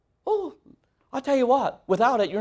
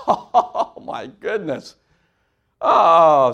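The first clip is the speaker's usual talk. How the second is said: laughing; 4 dB louder, much slower than usual. Real, not acted. Laughing at preacher joke.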